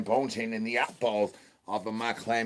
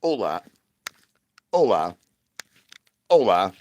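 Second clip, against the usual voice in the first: greeting building louder; 8 dB louder than usual. Acted. Streamer greeting the chat, each hola louder. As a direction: start at normal volume and get louder on each hola.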